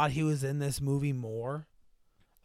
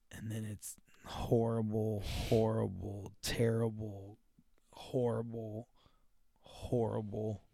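very slow, low and hushed; imitated haunted dad, drawn out quietly; grim muttered litany; 6 semitones lower, 10 dB quieter, slower than usual. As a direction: let each word sink heavily. Acted dad returns to grim list.

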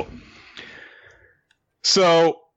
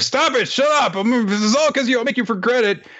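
angry shout. Acted. Two students in a confrontation, yelling angrily. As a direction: scream at the other student. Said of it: "They're like".

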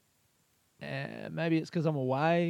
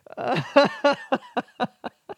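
breathless high giggle; real uncontrollable laughter; helpless high laugh; 9 semitones higher, 5 dB louder than usual. Real, not acted. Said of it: "I'm losing my shit".

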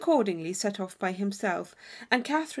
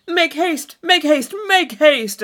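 loud call up the stairs, much louder; 6 semitones higher, 11 dB louder, slower than usual. A narrator's reading. Thorpe on the stairs hurrying Catherine, calling loudly. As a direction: shout up the stairs to hurry her. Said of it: "calling out to Miss Morland".

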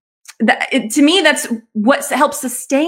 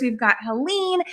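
normal talking voice

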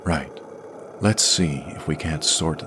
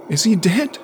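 put-on much higher, thin child's voice; an anxious boy's squeak; octave higher; 5 dB louder than usual. A narrator's reading. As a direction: pitch it up a full octave. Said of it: "a voice at least an octave higher than usual".

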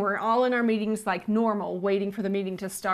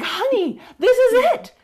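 much higher and louder, bursting with joy; excited husband; 7 semitones higher, 5 dB louder than usual. Retold or imitated. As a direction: shout it out like the best news ever. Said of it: "he got so excited".

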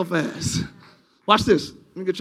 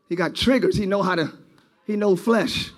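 ordinary delivery

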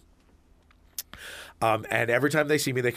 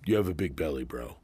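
lower, mock bro remark, fading off; a hint of a blunt dude; rude adult jab; 8 semitones lower, 5 dB quieter than usual. Adult stranger mocking a man's belly, low mock bro. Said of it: "put their hand on your belly and go".

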